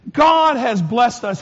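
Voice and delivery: ordinary talk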